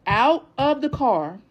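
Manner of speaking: out of the car, slow and loud; each word of "Out of the car" hammered slowly; slow emphatic. Real, not acted. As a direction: spell it out word by word. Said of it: "emphatic repeat of phrase".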